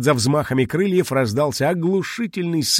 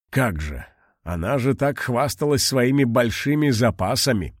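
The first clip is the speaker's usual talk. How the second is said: ordinary delivery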